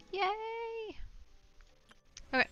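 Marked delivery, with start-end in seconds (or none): 0.1-1.0 high, drawn-out yay; celebratory cheer. Real, not acted. Cheers a finished task.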